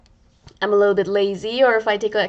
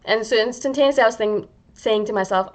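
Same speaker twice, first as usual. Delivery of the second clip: normal talking voice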